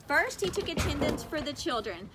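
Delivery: ordinary delivery, nothing marked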